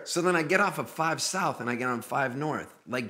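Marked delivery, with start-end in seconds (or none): none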